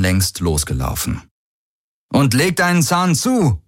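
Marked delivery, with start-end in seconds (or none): 2.1-3.6 high-pitched urging call; calling back. A narrator's reading. Garland runs ahead urging Hubert, high call. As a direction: call out to hurry someone along. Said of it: "rief er".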